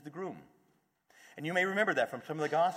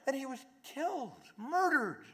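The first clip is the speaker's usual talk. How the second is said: a touch of the disciples' distress, raised high; grieving disciples; 10 semitones higher, 4 dB louder than usual. Retold or imitated. Disciples telling the stranger their loss, mildly anguished and high. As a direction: pour out the lament, lightly acted. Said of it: "they tell the whole story".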